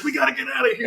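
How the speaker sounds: high, urgent call to run. Retold or imitated. Gigi urging escape from the yacht, high and urgent. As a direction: hurry them off in a panic, half-mock. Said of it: "she's like".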